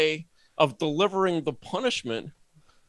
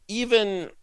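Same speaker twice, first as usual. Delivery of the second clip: normal talking voice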